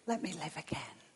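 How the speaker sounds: very soft, faint begging; hushed plea. Acted. Dead man begging God to let him live, hushed plea. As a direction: plead softly, almost under the breath.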